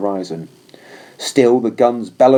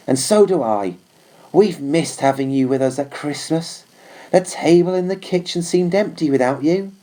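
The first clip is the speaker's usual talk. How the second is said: Trooper reading mother's letter; 6 semitones higher than usual. Acted. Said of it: "read it out to me in guarded whispers".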